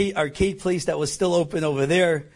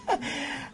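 embarrassed laugh; 11 semitones higher, 6 dB quieter than usual. Real, not acted. Laugh before quote.

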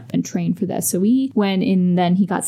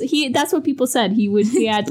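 ordinary talk, nothing marked

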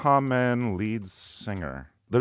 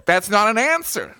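Lindsey Graham; 7 semitones higher than usual. Acted. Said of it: "This is supposed to be Lindsey Graham".

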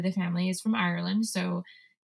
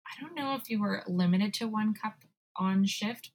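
normal talking voice

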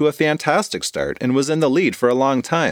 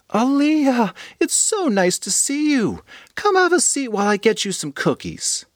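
doting grandma; 11 semitones higher than usual. Acted. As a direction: welcome her lovingly. Grandma voiced by narrator.

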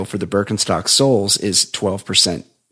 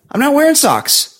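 high-pitched, exasperated protest; annoyed retort. Retold or imitated. Retelling his reply to an online heckler, annoyed. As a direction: protest in a much higher voice, fed up. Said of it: "I was real annoyed".

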